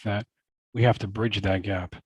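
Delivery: normal talking voice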